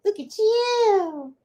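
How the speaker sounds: very high, drawn-out pet talk; doting coo. Real, not acted. Admiring the dog Mello, doting coo. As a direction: stretch YOU into a high coo. Second take, cooed to the dog.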